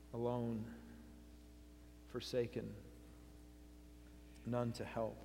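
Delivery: soft, low, with long pauses; hushed desolation. Acted. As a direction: let each word fall quietly into isolation. Dwells on Christ's isolation.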